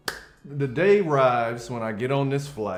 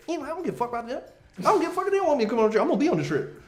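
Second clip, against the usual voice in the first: high, fast sassy comeback; sassy retort; 13 semitones higher, much faster than usual. Retold or imitated. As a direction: toss it off with sass. Couple's sassy retort voiced.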